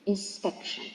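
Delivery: "inspection" with the beat on SPEC; stress second-last syllable. Shown as a demonstration. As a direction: model "inspection" as in-SPEC-tion. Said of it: "stressed in the second syllable".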